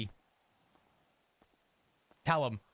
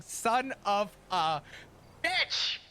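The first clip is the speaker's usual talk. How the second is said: mad outburst; 4 semitones higher, much slower than usual. Retold or imitated. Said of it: "I was so mad".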